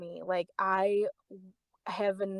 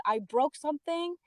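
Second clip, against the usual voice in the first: retold protest, pitched high; agitated, high-pitched retelling; raised voice; 7 semitones higher, 5 dB quieter than usual. Customer complaining at the cash register, raised voice. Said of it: "raising my voice".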